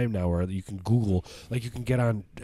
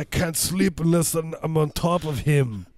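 louder, higher hammed-up Austrian accent; mock Austrian accent; 6 semitones higher, 3 dB louder than usual. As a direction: whine in a thick Austrian accent, exhausted. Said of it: "My Austrian accent".

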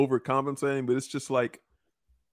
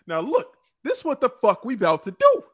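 Luther; 6 semitones higher than usual. Pretend. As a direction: shout it out furious. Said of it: "my anger translator here, Luther".

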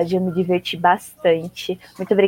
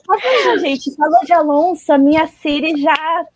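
laughing excitedly; 8 semitones higher, 5 dB louder than usual. Real, not acted. Laughing after Siri answers.

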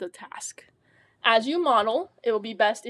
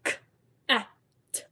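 sounding out words; faster than usual. Shown as a demonstration. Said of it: "When you blend the sounds in".

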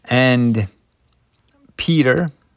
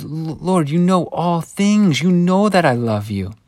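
grieved plea; 7 semitones higher, 3 dB louder than usual. Acted. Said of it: "Simon's just grieved".

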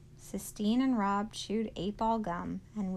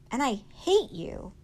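raised, louder hateful outburst; angry; 5 semitones higher, 8 dB louder than usual. A narrator's reading. Sistine lashing out at Rob, angry. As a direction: lash out, higher and louder.

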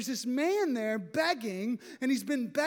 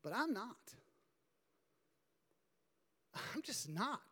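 much softer, falling admission; quiet confession; 4 semitones lower, 12 dB quieter than usual. Real, not acted. Pastor confessing to the congregation, very soft. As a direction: drop the voice, admit it honestly. Confessing his own weakness.

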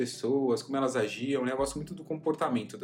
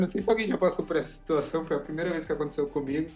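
higher, louder and faster, laughing at herself; higher, louder embarrassed rant; excited embarrassment; 5 semitones higher, 5 dB louder than usual. Real, not acted. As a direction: gush about the embarrassing moment, fast and loud.

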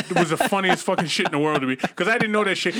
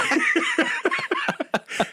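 laughter burst. Real, not acted. Laughing at own joke.

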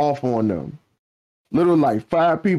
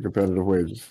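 much lower, quieter mock-corporate aside; deadpan repeat; 7 semitones lower, 5 dB quieter than usual. Co-host echoing the job ad perk, lower and deadpan. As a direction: drop the voice and repeat it dryly like fine print. Repeats own joke, dropped low.

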